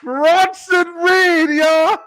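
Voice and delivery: high, loud, slow crowing; hyped cheer. Real, not acted. Bragging to friends about his pick, high and loud. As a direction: stretch out the name triumphantly.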